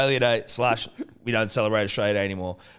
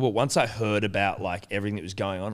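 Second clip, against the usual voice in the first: normal talking voice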